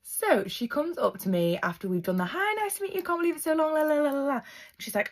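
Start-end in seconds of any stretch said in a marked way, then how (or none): 2.2-4.4 high, singsong mock pleasantries; gushy greeting. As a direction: rattle off polite small talk in a high mocking voice. Acting out gushy first greeting.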